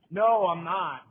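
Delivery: loud retold refusal; emphatic refusal. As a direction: shut him down loudly. Retold refusal to pushy beggar.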